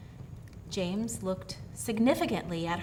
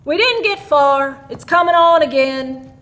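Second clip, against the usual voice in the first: a lightly voiced gruff farmer, louder; John the farmer; 6 semitones higher, 14 dB louder than usual. John coming in from the snow tells the women, louder. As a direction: come in from the snow, speak up. John's line.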